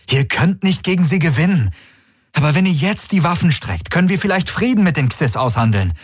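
lightly voiced desperate plea; pleading envoy. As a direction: beg her to call the ships back. Garlin pleads with Ylana.

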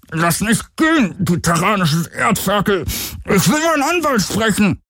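high, muffled protest through a covered mouth. A narrator's reading. Garland faking a captured stowaway for the comm, muffled protest. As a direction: protest half intelligibly, as if gagged. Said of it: "hielt sich selbst den Mund zu und protestierte nur halb verständlich".

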